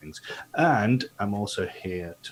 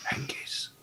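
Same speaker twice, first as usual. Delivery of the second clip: hushed whisper; 7 dB quieter than usual. Acted. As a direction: whisper the name so no one hears. Sneaking party, warning to be quiet.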